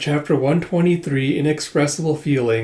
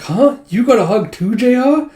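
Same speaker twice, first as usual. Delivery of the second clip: high, louder, rising astonishment; surprised question; 8 semitones higher, 5 dB louder than usual. Retold or imitated. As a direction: ask it in pitched-up disbelief. Yona is surprised by the hug.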